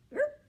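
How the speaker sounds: high, loud scratchy erp. A narrator's reading. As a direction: bark it out proudly. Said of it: "he added a bit louder".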